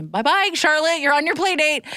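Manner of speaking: high, sing-out farewell; acted breezy goodbye. Leaving Charlotte on her play date, acted breezy goodbye.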